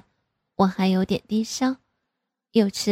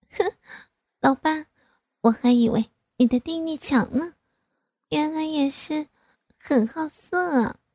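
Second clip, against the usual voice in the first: daughter's voice; 7 semitones higher than usual. Acted. Daughter voiced teasing father.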